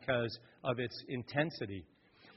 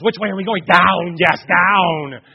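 mock grim reaper; 3 semitones higher, 12 dB louder, slower than usual. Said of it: "He goes".

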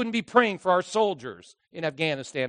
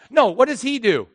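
ordinary talk

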